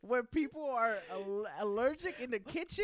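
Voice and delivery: mock outrage. Roasting his friend for the cat on the counter, in loud laughing mock outrage. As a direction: lay the scandal on thick, laughing.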